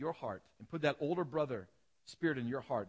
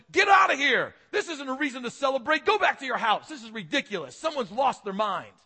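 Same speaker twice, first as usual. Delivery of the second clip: much louder and higher, shouting guests away; furious shout; 11 semitones higher, 12 dB louder than usual. Retold or imitated. Older brother shouting at guests arriving for the party, enraged. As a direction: bark them off the property in a rage. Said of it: "he's yelling".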